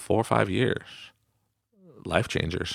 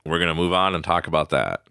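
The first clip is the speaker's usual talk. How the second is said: usual speaking voice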